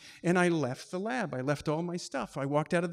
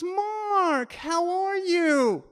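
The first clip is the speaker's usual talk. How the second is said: much higher, cheery hello; bright greeting; 12 semitones higher, 6 dB louder, much slower than usual. Retold or imitated. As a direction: greet him sweetly and happily. Clarissa greets him brightly.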